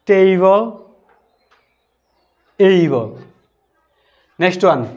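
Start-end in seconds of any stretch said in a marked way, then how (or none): 0.1-3.1 "টেবল" and "এইবল" with an "ei" vowel; correct English pronunciation. Shown as a demonstration. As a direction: model each word slowly and carefully. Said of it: "তাহলে সঠিক উচ্চারণ হবে". ("টেবল, এইবল" সঠিক উচ্চারণে)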